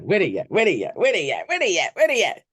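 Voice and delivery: rally the chat, building up; hype call-out. Real, not acted. Hyping the chat, calling out.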